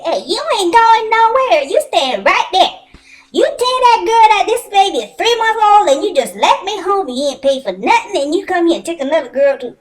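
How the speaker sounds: loud, high, furious retold yell at the man; imitated angry yelling. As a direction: shout him down like a scorned baby mama. Baby mama confronts him in theater.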